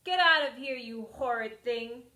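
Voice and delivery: angry cry. Acted. Granny shouts at the wolf, angry and loud. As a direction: drive him out furiously. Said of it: "she cried".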